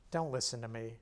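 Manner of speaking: usual speaking voice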